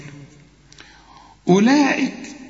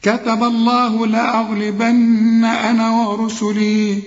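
Quran recitation; 6 semitones higher, much slower than usual. Shown as a demonstration. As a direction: chant the verses with long held vowels. Full recitation of the verses.

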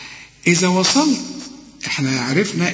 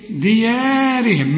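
ديارهم with the "yaa" vowel slowly drawn out; natural madd; 3 semitones higher, much slower than usual. Shown as a demonstration. Teacher showing where the madd falls, ديارهم drawn out. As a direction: lengthen the alif in ديارهم. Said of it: "مد طبيعي".

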